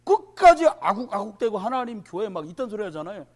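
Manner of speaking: ordinary talk, nothing marked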